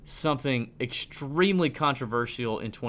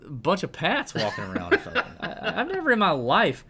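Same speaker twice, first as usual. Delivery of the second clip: higher, louder, laughing through the words; laughing disbelief; 10 semitones higher, 5 dB louder than usual. Real, not acted. Host laughing about touchy Twitter followers, loud and high.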